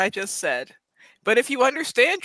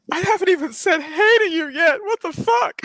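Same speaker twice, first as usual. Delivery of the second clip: rising indignant outburst; 7 semitones higher than usual. Acted. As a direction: get more and more indignant.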